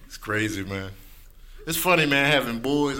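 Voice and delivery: ordinary talk, nothing marked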